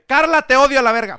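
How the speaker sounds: loud laughing yell at the ex; mock angry shout. Real, not acted. Amigo gritando entre risas contra su ex Carla.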